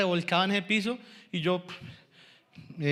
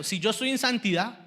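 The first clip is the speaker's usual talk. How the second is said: normal talking voice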